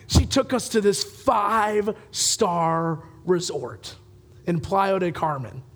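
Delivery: ordinary talk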